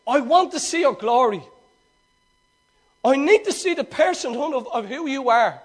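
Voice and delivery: full-voiced, insistent demand of God; earnest, hungry plea. Shown as a demonstration. Preacher shows Moses longing to see God, urgent. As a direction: plead it with full voice, every word meant. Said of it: "was he more like".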